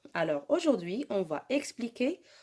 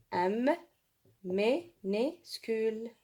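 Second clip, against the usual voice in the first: "M", then "minuscule" slowly split as mi-nu-scule; syllable-by-syllable dictation; much slower than usual. Shown as a demonstration. Teacher writing for pupils, slow syllable dictation. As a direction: say "M minuscule" with a gap after each syllable.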